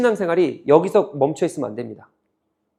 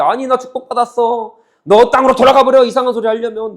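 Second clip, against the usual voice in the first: retort; 4 semitones higher, 5 dB louder than usual. Retold or imitated. Israelites retorting to Amos.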